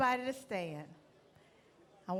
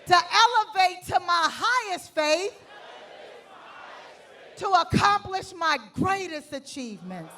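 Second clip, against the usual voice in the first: shouted with full conviction, much louder; 6 semitones higher, 12 dB louder than usual. Shown as a demonstration. Speaker shows the audience how to say it with conviction. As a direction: bellow it like you mean every word. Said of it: "say it with some conviction".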